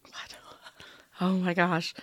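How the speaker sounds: normal talking voice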